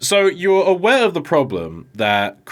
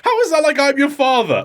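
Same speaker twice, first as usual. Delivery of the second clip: much higher, laughing disbelief at the line; incredulous; 13 semitones higher, 4 dB louder than usual. Real, not acted. Mocking the dub's Vader moment, laughing in disbelief. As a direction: scoff through a laugh. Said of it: "that'd be stupid".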